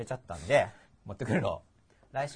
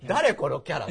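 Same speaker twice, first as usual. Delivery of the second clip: loud, higher baffled outburst; mock bewilderment; 4 semitones higher, 13 dB louder, slower than usual. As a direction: exclaim it like a thoroughly lost viewer. Acting out a baffled viewer. (大声で大げさに戸惑う視聴者の声)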